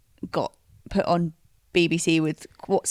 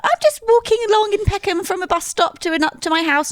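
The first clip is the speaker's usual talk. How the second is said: high, louder and carefree; breezy carefree voice; 4 dB louder, faster than usual. Retold or imitated. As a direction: chirp it like nothing's wrong. Mimicking her friend's breezy reply.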